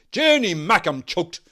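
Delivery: loud, high howl of complaint. A narrator's reading. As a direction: howl it at the fire. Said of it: "He howled".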